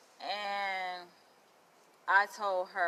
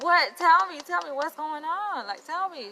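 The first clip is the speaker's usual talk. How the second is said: high and loud, pleading for the story; begging to hear the gossip; eager begging; 10 semitones higher, 12 dB louder than usual. Retold or imitated.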